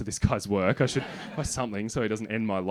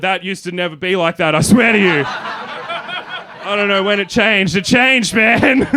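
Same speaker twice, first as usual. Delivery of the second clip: loud, high, flustered protest; high-pitched desperate defence; panicked excuse; 8 semitones higher, 10 dB louder, slower than usual. Retold or imitated. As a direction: plead innocence in a hammed-up panic. Flustered excuse to angry man.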